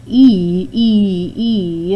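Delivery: E called out loud and long, each one stretched; sounding out E. Shown as a demonstration. Teacher calling out the long E sound for students. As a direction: sing out E, E, E to the class. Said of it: "it can all be spelled with a Y".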